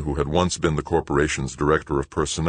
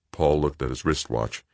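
usual speaking voice

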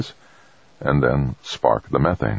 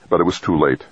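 ordinary delivery, nothing marked

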